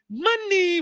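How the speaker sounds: high, squeaky sung-out money; talking credit card. Retold or imitated. The shining platinum card calling out, high and squeaky. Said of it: "That motherfucker said".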